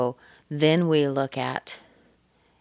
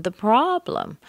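PROBLEM lifted high on its first syllable; stress on PROBLEM; 6 semitones higher than usual. Shown as a demonstration. Teacher shows the other focus word, stressing PROBLEM. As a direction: hit the start of PROBLEM high. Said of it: "maybe I would stress problem more".

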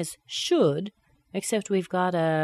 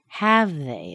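slow "have they" dropping down; falling intonation; 4 dB louder than usual. Shown as a demonstration. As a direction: stress "have", then fall away on "they". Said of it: "It drops down".

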